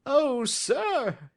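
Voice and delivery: high, loud, eager cry of a timid little man; high excited plea from a jittery little fellow; nervous little man crying out. A narrator's reading. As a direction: reach out to him, voice up high and pleading. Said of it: "he cried".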